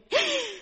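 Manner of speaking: sharp gasp. Acted. Realization about own husband.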